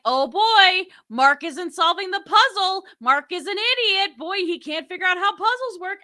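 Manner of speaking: high, loud mocking host; smug host voice. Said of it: "be like".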